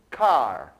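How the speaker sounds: car said the English way, for students to repeat. Shown as a demonstration. Teacher models car for students to repeat, in English pronunciation. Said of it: "Repitam comigo".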